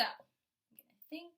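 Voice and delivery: slow, drawn-out thinking voice; thinking out loud. Shown as a demonstration. Teacher modeling thinking for kindergartners, slow and musing. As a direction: muse aloud slowly, pitch lifting. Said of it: "I'm gonna first think about what I did".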